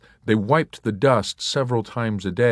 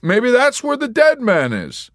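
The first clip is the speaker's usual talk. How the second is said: high, loud eerie suggestion; Yossarian; 8 dB louder than usual. A narrator's reading. Yossarian spooking the nurse, high and loud. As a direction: drop it like a ghost story.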